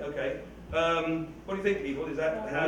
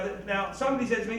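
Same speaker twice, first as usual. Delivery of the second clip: normal talking voice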